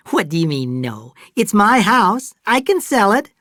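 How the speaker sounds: touch of indignation; indignant Fay. A narrator's reading. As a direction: bristle at being denied. Fay offended by refusal.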